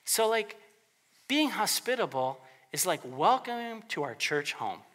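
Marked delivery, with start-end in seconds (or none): 3.0-4.8 warm welcome. Acted. Greeting a newcomer at church.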